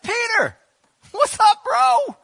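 high, loud, easy greeting; casual Tabitha. Retold or imitated. Tabitha greeting Peter casually, high and loud. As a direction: greet him like an old pal. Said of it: "she just got up and said".

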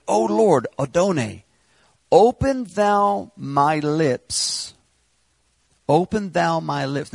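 high, loud, drawn-out plea to God; preacher crying out the psalm; fervent preaching. Acted. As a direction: stretch the words into a pleading cry.

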